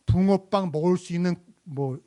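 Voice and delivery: ordinary talk, nothing marked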